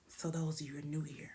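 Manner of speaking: whispered aside to the newcomers. Real, not acted. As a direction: lean in and whisper it like gossip. Confiding aside to new viewers.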